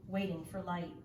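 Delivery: plain reading voice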